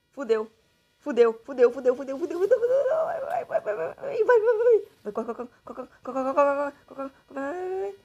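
panicked high shouting; frantic loud high cries while fleeing; being chased. Real, not acted.

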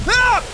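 sudden high shriek, loud; startled scream. Real, not acted. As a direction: yelp in real fright. Startled by a jump scare.

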